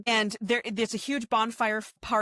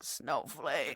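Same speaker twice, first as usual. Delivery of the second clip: weak whisper of name; 6 semitones lower, 7 dB quieter than usual. Retold or imitated. Waking lover says name weakly.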